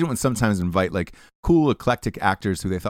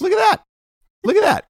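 excited; 3 dB louder, faster than usual. Acted. Hyping the sponsor's shipping.

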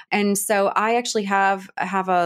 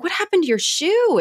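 surprised concern; 10 semitones higher than usual. Retold or imitated. Husband startled, asking the boy about his shoe. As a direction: sound startled at the missing shoe. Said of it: "he's like".

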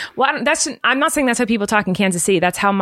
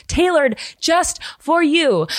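slow, higher sing-song just for you; a touch of sweet ad pitch; 7 semitones higher, slower than usual. Acted. Host reading sponsor ad, lightly sugary sales voice. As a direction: coo it to the listener like an ad. Ad read flourish.

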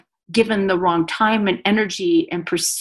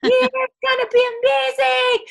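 hammed-up giddy high voice, much higher; mocking everybody's hype. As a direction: squeal it like an overexcited crowd, ending in a shout. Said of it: "everybody was like".

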